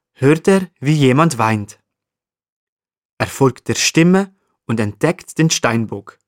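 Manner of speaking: usual speaking voice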